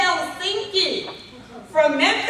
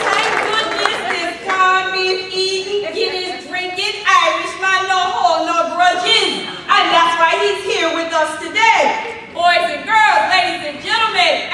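announcer hype; 5 dB louder than usual. Acted. Hyped introduction of the speaker.

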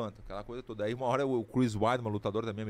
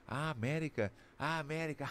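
mild, softer mock of another's words; joking mimicry; 6 dB quieter than usual. President mocking American guest, lightly imitated teasing. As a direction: echo it back teasingly, breaking into a laugh. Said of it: "Fez brincando dos nossos".

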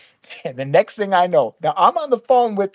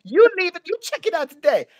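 angry yelling woman; 11 semitones higher than usual. Retold or imitated. Hotel clerk throwing out a rude guest, mock angry yelling. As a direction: imitate a woman cussing someone out, voice pitched way up.

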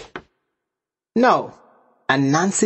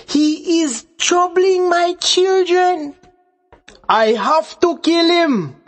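loud shout; 11 semitones higher than usual. Acted. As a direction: shout a false alarm to the family, pitched way up. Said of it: "he shouted".

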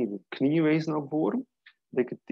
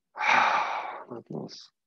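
breathy release on exhale; much slower than usual. Shown as a demonstration. Teacher whispers the release to the class on the exhale. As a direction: let it go on the breath, no voice.

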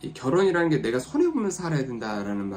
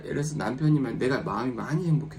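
ordinary delivery

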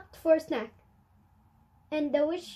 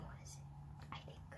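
soft, lower whisper of a guess; 23 dB quieter, much faster than usual. Real, not acted. Reader whispering a guess about the picture. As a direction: keep the secret guess hushed.

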